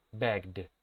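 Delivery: low, soft "Begged" as one syllable, begd. Shown as a demonstration. Teacher models the word for class, "Begged" with -ed as D. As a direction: close "Begged" on a voiced D. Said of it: "se va a pronunciar la E D como si fuera una D".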